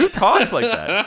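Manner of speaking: high, incredulous question through laughter; laughing disbelief. Retold or imitated. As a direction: ask it baffled, cracking up. Repeats the baffled question, laughing.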